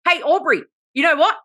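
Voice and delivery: high, emphatic mock announcement; imagined address. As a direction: hail someone brightly with pretend gusto. Imagined speech addressed to Aubrey.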